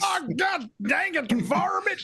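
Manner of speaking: high shouting cartoon cowboy; Yosemite Sam. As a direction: yell like a hotheaded cartoon outlaw. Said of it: "That's a different voice".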